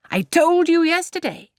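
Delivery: high, loud triumphant cry; exultant cry. A narrator's reading. Mr Weston triumphs to everyone, loud exultant cry. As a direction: crow it out in triumph. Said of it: "cried Mister Weston with exultation".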